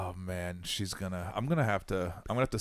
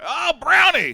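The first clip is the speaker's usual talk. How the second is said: much higher, loud call across the dock; 9 dB louder than usual. Retold or imitated. Friend on the dock calls out to Brownie, loud greeting. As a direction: hail a buddy on a boat.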